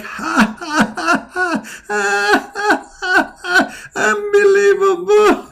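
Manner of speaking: high, shrill mock devil laughter; Shaitan cackling. Shaitan mocking the yawner, high cackling laugh. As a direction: cackle like a gleeful little devil inside a mouth. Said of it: "and he is like".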